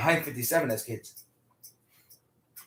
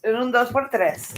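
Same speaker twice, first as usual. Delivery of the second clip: high, loud, slow cartoon mouse voice with a Mexican accent; Speedy Gonzalez; 9 semitones higher, 10 dB louder, slower than usual. Joking with friends over drinks, a high, loud cartoon mouse voice. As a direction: belt it out like a cartoon mouse racing off. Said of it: "Like Speedy Gonzalez".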